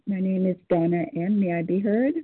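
ordinary delivery

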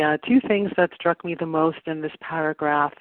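ordinary talk, nothing marked

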